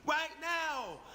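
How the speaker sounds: high-pitched alarmed inner voice; retold fear. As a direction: replay the panicked thought that you may be killed. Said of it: "I'm scared as shit".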